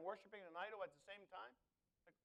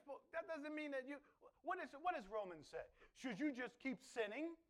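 ordinary delivery

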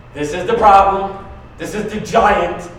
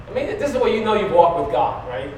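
ordinary talk, nothing marked

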